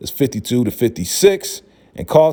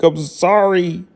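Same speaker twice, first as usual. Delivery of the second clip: whiny sorry; 7 semitones higher, slower than usual. Retold or imitated. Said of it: "It's like".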